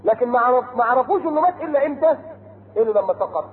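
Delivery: normal talking voice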